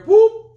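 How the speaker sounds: a sudden squeaky high "Boop" as a button click; high boop sound effect. Pretend. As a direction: pop out a tiny squeaky boop like a button press. Mimicking a share button click.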